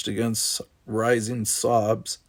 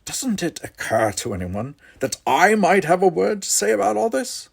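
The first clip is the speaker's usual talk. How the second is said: indignant; 9 semitones higher, 4 dB louder than usual. Acted. Son finally demands a say.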